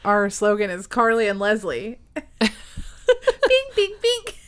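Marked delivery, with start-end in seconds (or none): 2.4-4.3 sing-song bing. Pretend. Playful sound effect.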